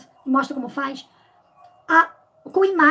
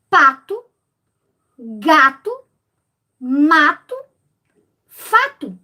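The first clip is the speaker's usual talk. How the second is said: pato, gato, mato, fato, each said louder with a pause after it; rhyming words, each set apart; 5 dB louder, much slower than usual. Shown as a demonstration. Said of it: "por exemplo, eu posso ter". (rimas pato, gato, mato, fato ditas separadas e mais alto)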